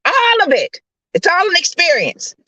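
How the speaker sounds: high, emphatic exclamation; fervent preaching. Real, not acted. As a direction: drive the point home like a preacher.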